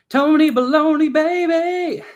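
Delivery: loud, high, drawn-out whoop; hyped holler. Real, not acted. Greeting friend Tony in the chat, hyped holler. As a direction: belt out the nickname like a hype man.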